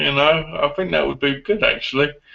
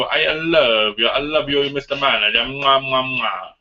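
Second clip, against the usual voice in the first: mock Italian gushing. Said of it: "I go".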